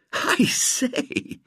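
breathless high gasp. A narrator's reading. Robert gasps at the kindness, breathless and amazed. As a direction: gasp it out, almost no voice. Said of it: "gasped Robert".

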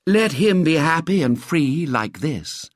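slow, high declaimed appeal; pleading legend read aloud; solemn poster slogan. A narrator's reading. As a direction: proclaim it as a slogan, drawn out.

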